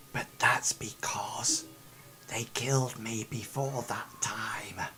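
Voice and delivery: whispering ghostly NPC; hushed storytelling. Acted. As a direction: tell it like a haunted survivor.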